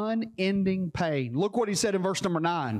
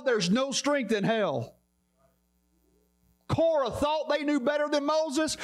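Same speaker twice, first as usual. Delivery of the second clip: impassioned preaching; 7 semitones higher than usual. Real, not acted. Preacher warning the congregation about hell, impassioned preaching. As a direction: preach it urgently, driving through each phrase.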